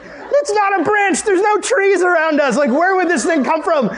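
very high-pitched panicky protest; freaking out. Acted. Family answering dad's branch excuse, freaking out. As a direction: argue back in hammed-up alarm. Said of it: "we're freaking out really bad now".

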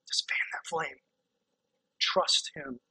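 whispered encouragement. Real, not acted. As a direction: breathe it to them intimately. Quiet climax of sermon.

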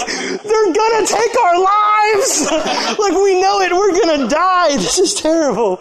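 high, hammed-up doomed wail; exaggerated panicked shriek; mock panic. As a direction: cry out in comic terror, laughing through it. Acting out team's panicked reaction to speech.

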